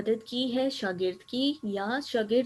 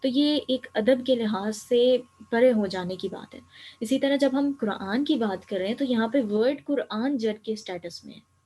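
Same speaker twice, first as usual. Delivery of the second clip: ordinary talk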